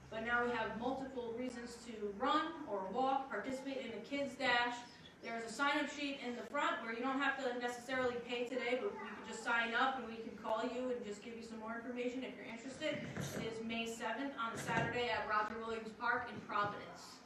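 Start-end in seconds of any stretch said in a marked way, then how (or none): none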